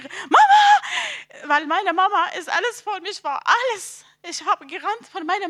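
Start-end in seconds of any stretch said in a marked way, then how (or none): 0.0-1.5 high, loud cry for mother; calling out. Retold or imitated. Daughter seeing her mother in a dream, calling out. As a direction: shriek it out as if running to her.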